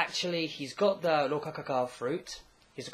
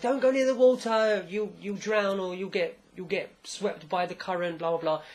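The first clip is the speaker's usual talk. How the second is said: raised-pitch mother calling out a warning, fading off; retold scolding warning; 4 semitones higher, 4 dB louder than usual. Woman to child near the water, scolding warning. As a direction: warn the child sharply, then let it tail away.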